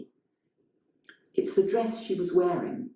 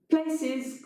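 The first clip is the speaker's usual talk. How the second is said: higher call to the cast; 12 semitones higher than usual. Acted. Director Elena calls the cast to places, a raised call. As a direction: call the actors to their places. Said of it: "calls Elena".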